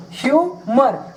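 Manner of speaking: Humour said slowly, hyu-mar stretched; slow model word. Shown as a demonstration. Teacher slowly modelling humour for students. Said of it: "teacher models word slowly".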